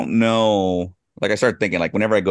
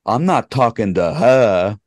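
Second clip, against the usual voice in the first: put-on louder, drawn-out Boston accent; 4 semitones higher, 6 dB louder than usual. Boston local overheard at a restaurant table, imitated Boston accent. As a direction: imitate a loud Boston local, stretching the words. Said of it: "listening to people ... their speech was intoxicating".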